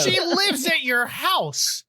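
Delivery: high, slow disbelief; incredulous. Retold or imitated. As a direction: spell it out in disbelief. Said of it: "I'm like".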